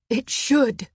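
tense, insistent reply; sharp retort. A narrator's reading. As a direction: shoot it back at him. She argues back in quarrel.

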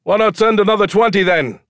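high, loud heckle from the crowd; shouting. A narrator's reading. Onlooker heckles the showman from the crowd, shouting. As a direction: shout it out over everyone. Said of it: "someone shouted".

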